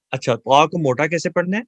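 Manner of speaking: ordinary talk, nothing marked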